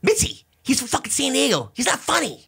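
very high mock yelling; imitated Rogan. Rogan complaining to Mitzi about the comic, high strained mock yell. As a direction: squeal it like a hammed-up heckler.

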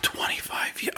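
hushed, breathless disbelief; stunned whisper. Retold or imitated. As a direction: whisper it like you can't believe your ears. Stunned by applicant's experience.

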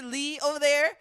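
normal talking voice

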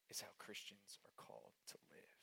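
hushed, breathy whisper. Real, not acted. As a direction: lean in and whisper the point. Whispered key point.

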